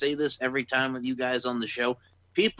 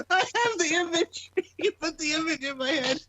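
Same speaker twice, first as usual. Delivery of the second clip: high, squeaky, laughing through words; 13 semitones higher than usual. Real, not acted. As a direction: crack up while speaking. Laughing hard at the joke.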